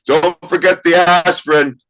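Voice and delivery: high, loud call down the aisle; yelling out. Retold or imitated. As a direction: shout it after someone running away. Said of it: "someone yells out".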